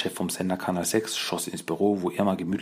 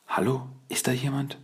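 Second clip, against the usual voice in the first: höher gesetzte Frauenstimme, fragend ins Dunkel; 7 semitones higher than usual. A narrator's reading. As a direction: ruf zaghaft als gefangene Frau ins Dunkle. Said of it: "hörte Splinter eine Frauenstimme". (hohe, unsichere Frauenstimme ruft ins Dunkel)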